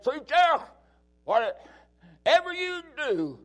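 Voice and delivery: heartfelt stranger's urging; earnest plea. Retold or imitated. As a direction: beg him not to give up.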